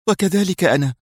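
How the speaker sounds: high-pitched, eager woman's voice; lightly voiced heroine exclaiming; exclaimed. A narrator's reading. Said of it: "وهتفت منى".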